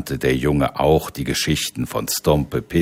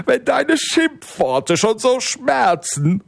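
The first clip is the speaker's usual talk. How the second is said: high, fearful giant cry; a frightened giant calling out. Acted. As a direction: giant crying out as he flees the boy. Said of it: "rief der Riese".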